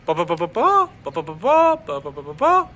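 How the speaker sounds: bouncing "प, प, प" with one beat leaping high; up-and-down rhythm. Shown as a demonstration. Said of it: "ऊंचा नीचा होता है".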